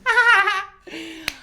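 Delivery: bright burst of laughter at her own grammar slip; high laugh. Real, not acted.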